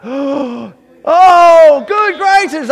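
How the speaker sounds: joyful scream. Retold or imitated. Man just freed, screaming his relief aloud. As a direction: cry out in overwhelming joy.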